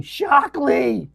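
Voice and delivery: loud, high imitation of her yell; yelled name. Recalling an actress in a film, yelling. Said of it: "All I remember from her is yelling".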